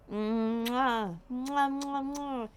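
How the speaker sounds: smacking kisses at pet; kiss sounds. Real, not acted. Kissing the cat.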